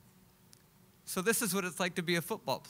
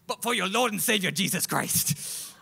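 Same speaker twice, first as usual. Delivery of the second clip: preachy motivational speaker. Retold or imitated. Motivational speaker invoking Jesus.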